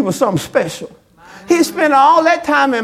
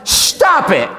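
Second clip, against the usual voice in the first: stern rebuke; slower than usual. Shown as a demonstration. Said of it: "So we could say it like this".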